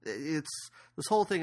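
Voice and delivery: usual speaking voice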